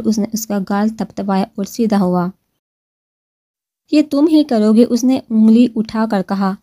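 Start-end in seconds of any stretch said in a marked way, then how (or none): 3.9-4.8 higher and louder, a sharp incredulous challenge; indignant question. A narrator's reading. Girl to husband refusing to kneel, higher louder disbelief. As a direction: throw the question back at him in disbelief.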